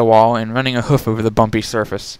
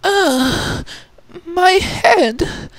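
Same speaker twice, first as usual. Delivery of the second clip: higher, mildly groaning Rarity; much slower than usual. Acted. Rarity waking in the cave, higher with a mild groan. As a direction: groan as you wake up sore. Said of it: "Rarity groaned".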